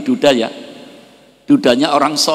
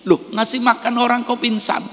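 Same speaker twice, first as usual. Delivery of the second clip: much higher, startled question; startled cry; 11 semitones higher than usual. Acted. Shocked husband cries out as his wife faints, much higher.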